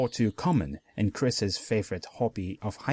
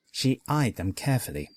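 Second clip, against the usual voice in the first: ordinary delivery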